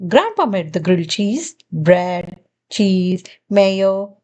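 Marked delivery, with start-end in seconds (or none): none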